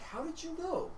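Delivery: a dim troll caught out, rising up high; surprised troll. Acted. The troll amazed the ogre knew, high and soft. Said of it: "the other one's like".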